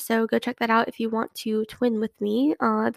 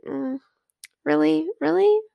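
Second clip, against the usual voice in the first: doubtful "really", pitched higher each time; sceptical really; 5 semitones higher than usual. Retold or imitated. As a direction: question it, unconvinced. Mock doubt at show-offs.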